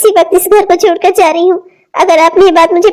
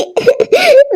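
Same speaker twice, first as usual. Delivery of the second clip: sob; 7 semitones higher than usual. Acted. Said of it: "मैं रो रही हूँ".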